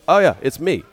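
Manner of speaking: spring the surprise on him with a grin; cocky Trevino quip. Retold or imitated. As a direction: Trevino gleefully revealing himself as the opponent. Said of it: "he's like".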